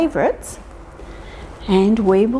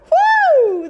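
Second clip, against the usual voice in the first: a high, loud whoop; a gleeful whoop as the mouse bounces; excited woo; 5 dB louder than usual. Acted.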